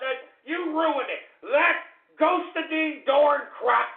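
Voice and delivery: high-pitched furious blame; exasperated outburst climbing to a shout; angry scolding. Real, not acted. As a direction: build from scolding to a loud yell. Blames troll for ruining it.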